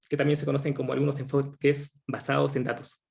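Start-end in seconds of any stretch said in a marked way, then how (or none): 2.1-3.0 speaking with a head cold; congested, clearing the throat; stuffy nose. Real, not acted. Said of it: "estoy un poco resfriado".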